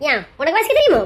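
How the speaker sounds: low, pointed "தெரியுமோ" question; challenging retort. Acted. Woman challenging man on his reading, low retort. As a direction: throw the question back, pitched low.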